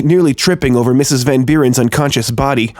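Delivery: plain reading voice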